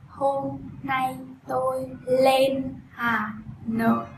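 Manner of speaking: very slow "Hôm nay tôi lên Hà Nội", clear L and N; drawn out, every L and N clear; slow careful L N model; slow model sentence. Shown as a demonstration. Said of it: "khẩu hình miệng thì nói là N".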